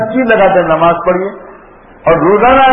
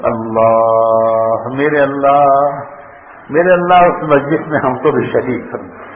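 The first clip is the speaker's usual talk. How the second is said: heartfelt prayer; 5 semitones lower, 3 dB quieter, slower than usual. Real, not acted.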